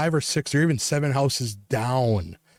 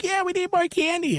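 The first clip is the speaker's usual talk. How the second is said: put-on high, squeaky child voice; imitated eager kid. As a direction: mimic a kid keen for candy.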